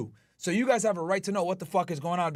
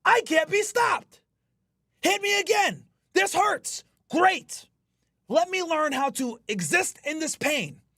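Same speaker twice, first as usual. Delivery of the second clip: much higher and louder, roaring invincible self-talk; triumphant shout; 11 semitones higher, 4 dB louder, slower than usual. Retold or imitated. Streamer telling viewers his gym mindset, roaring triumphant shout. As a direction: shout it like you can't be stopped. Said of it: "then you realize".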